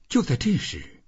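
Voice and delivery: ordinary delivery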